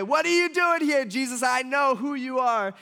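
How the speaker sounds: loud, high-pitched outburst; demons confronting Jesus. Retold or imitated. Demons running up to Jesus, crying out loudly. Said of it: "run up to Jesus and say".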